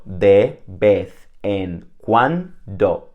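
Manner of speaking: de vez en cuando said slowly, each syllable apart; slow syllable count. Shown as a demonstration. Teacher counting syllables for students, slow and clear. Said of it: "Only five syllables".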